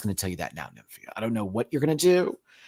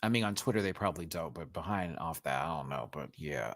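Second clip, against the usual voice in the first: much lower and far softer, to herself; muttered aside; 8 semitones lower, 12 dB quieter than usual. Real, not acted. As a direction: mutter it under your breath. Muttered aside about Twitter.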